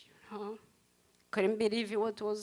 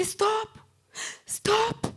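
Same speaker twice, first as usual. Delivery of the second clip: high, urgent call to the driver; shouting stop; 9 semitones higher than usual. Retold or imitated. To the driver, urgently yelling to stop. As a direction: yell it out to halt the car.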